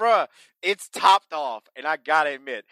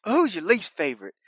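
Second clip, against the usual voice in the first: high, hammed-up southern wrestler voice; mock country wrestler talking to a kid; imitating Dixie Dynamite; 7 semitones higher than usual. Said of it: "I said".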